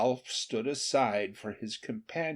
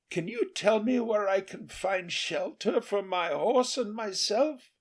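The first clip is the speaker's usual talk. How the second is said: a lightly voiced king, raised pitch; king asking; 9 semitones higher than usual. A narrator's reading. King asks the peasant for shelter, lightly voiced. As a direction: ask for shelter, hopeful. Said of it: "asked the king".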